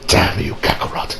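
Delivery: low, whispered Vegeta grumble; bitter Vegeta. Retold or imitated. Vegeta cursing his rival, low and whispered. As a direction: spit it out under your breath in a low voice.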